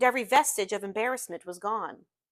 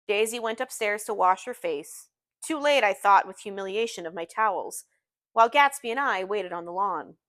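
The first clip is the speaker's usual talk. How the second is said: ordinary delivery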